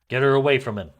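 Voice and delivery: loud urgent order; urgent command. Acted. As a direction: bark the order. Audition line retake.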